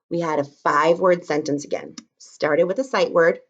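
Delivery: ordinary talk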